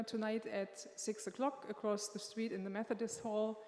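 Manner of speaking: ordinary delivery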